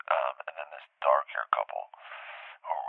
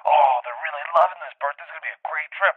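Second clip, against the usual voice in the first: high, loud gushing; giddy crew delight; excited; 10 semitones higher, 5 dB louder than usual. Retold or imitated. Producers telling Bert the guests love it, gushing.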